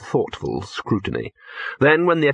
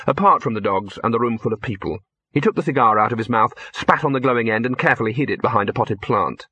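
ordinary delivery, nothing marked

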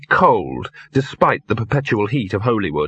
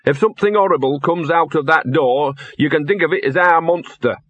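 higher-pitched, lightly voiced dog; lightly voiced talking dog; 5 semitones higher than usual. Narrator voices the dog Gaspode.

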